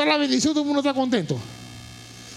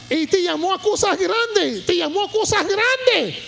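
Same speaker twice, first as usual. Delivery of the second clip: high, loud, impassioned shouted sermon; full-force preaching; 10 semitones higher, 5 dB louder than usual. Real, not acted. Preacher voices God asking who believes, full-force preaching. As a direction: shout it out as God's urgent call to the congregation.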